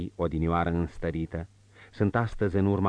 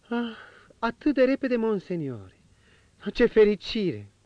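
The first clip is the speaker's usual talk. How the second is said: higher woman's voice, eager and glad; delighted marchioness. Acted. Marchioness thrilled at the prince's news, in a higher, eager woman's voice. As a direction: lift up with sudden joy.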